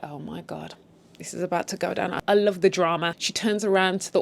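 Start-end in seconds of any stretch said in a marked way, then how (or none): 0.0-2.2 softer, hushed anticipation; hushed oh my God. Retold or imitated. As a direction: half-whisper it like the drama is coming. Bracing for drama ahead.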